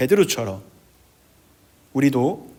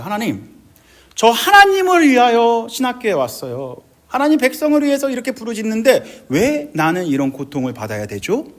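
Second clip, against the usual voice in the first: raised, loud retold protest; confronting God; 6 semitones higher, 4 dB louder than usual. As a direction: plead and argue as if wronged. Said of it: "라고 하나님 앞에 따졌습니다". (하나님께 따지듯 호소)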